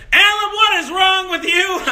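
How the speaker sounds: exasperated mom calling out; mom's reaction. Retold or imitated. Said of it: "my mom said".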